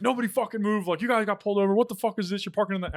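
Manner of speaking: high-pitched imitated police rant; irate cop. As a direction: berate them like an officer at a traffic stop. Retold cop berating them at car.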